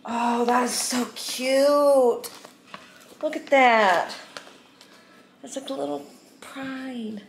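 high, slow, squealing delight. Real, not acted. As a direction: gush over the cute hat. Delighted by gift hat.

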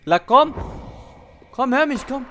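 ordinary talk, nothing marked

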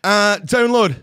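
high, loud radio advert plug. Pretend. Co-host tells listeners where the app is, radio voice. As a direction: punch it out like a radio presenter. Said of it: "Do it in your radio voice".

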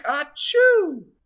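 big loud sneeze. Acted. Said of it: "The snowman sneezed a gigantic".

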